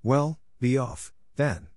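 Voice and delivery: a slow, sulky brush-off; mildly sulky bird shooing her off. A narrator's reading. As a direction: drag out a grudging dismissal. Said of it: "said the pigeon in a sulky tone".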